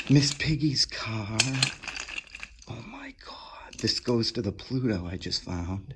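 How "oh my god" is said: slow, breathy high whisper of disbelief; awed whisper. Real, not acted. Collector whispering at a shelf of old toys, stunned. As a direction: barely get the words out. Spotting another treasured toy.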